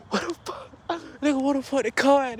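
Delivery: high-pitched retold panic; alarmed disbelief. Runner discovers the car is missing, high alarmed disbelief. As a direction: replay the alarm, voice jumping up. Acts out finding car gone.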